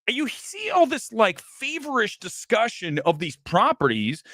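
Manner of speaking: ordinary talk, nothing marked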